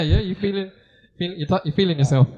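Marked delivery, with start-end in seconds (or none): none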